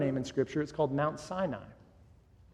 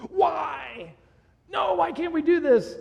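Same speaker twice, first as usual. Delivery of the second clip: put-on much higher, louder whiny kid protest; whining child; 13 semitones higher, 6 dB louder, slower than usual. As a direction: mimic a kid begging to stay at the playground. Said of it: "that's kind of whining and complaining".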